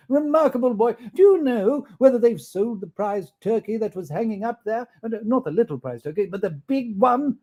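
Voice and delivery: high, eager question; eager Scrooge. Acted. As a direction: ask the boy eagerly. Scrooge asking boy.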